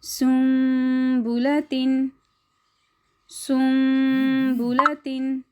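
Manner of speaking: सुम्बुलतिन drawn out with nasal म before ब; slow recitation. Shown as a demonstration. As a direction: recite सुम्बुलतिन slowly, humming the म. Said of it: "अगला लफ्ज़ देख लें". (धीमी तजवीद क़िराअत)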